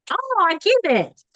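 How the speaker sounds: ordinary talk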